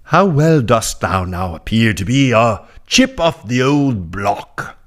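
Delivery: mock Old English accent, a touch hammed-up. Shown as a demonstration. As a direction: read the quote like an Old English orator. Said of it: "unless it's in an old English accent".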